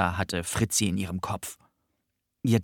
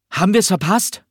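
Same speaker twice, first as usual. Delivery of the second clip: much higher, louder call across the hall; boy calling out while rushing into the hall; called from afar; 11 semitones higher, 8 dB louder than usual. A narrator's reading. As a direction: shout it from far off as you run in. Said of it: "rief er schon von weitem".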